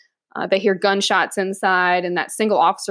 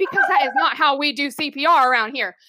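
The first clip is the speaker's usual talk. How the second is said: high, fast outburst of indignation; retold indignant squawk; mock scolding; 7 semitones higher, faster than usual. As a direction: tell off a clumsy trainee in mock outrage. Retold scolding of clumsy medic.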